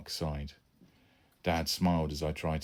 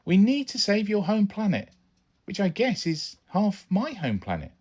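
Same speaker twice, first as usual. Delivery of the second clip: put-on boy's voice; 6 dB louder than usual. Son keeps arguing.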